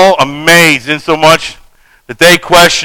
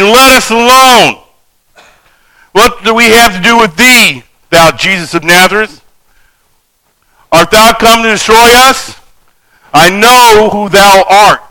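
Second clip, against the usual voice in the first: demon crying out; 4 semitones higher than usual. Acted. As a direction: voice the demon begging to be left alone. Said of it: "and he cried out, saying".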